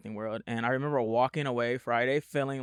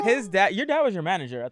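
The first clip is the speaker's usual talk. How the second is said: ordinary talk, nothing marked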